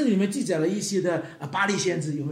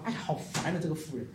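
soft, resigned mock grumble; lightly acted exasperated judge; imitated weary annoyance; 2 semitones lower, 7 dB quieter than usual. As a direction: give in, fed up with the nagging.

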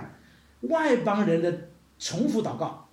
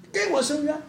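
high, repeated demand; acted persistent widow's plea, pitched up; imitated insistent pleading; 6 semitones higher than usual. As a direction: beg the judge over and over. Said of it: "就是不停地求".

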